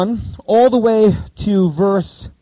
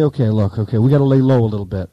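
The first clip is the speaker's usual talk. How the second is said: hushed low plotting; 8 semitones lower, faster than usual. Retold or imitated. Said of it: "So you think Jesus would say".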